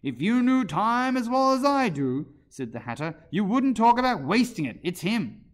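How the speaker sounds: pompous, exclaiming Hatter; emphatic Hatter. Acted. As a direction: lecture loudly in a high character voice. Voices the Hatter.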